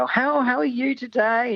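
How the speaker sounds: high-pitched pet voice. Retold or imitated. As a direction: talk down as if to a puppy. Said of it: "that high-pitched voice that they use for children and dogs".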